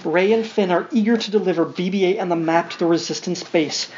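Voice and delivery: usual speaking voice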